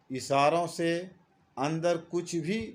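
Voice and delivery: usual speaking voice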